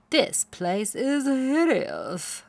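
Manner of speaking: drawn-out disdain for the new farm; snooty pony voice. A narrator's reading. Spoiled pony sneering at her new home, haughty. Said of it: "said Plumpkin".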